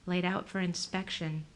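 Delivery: ordinary delivery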